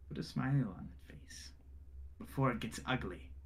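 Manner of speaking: quiet menace. Acted. Noble quietly threatens grieving Thrud, soft menace. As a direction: lean in and threaten him softly.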